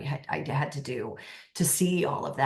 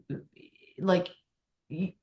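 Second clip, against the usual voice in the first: ordinary talk, nothing marked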